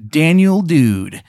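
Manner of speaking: a surfer dude playing doctor; surfer bro doctor. Doctor Daniel Dude greeting his patient, bro voice. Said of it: "He goes".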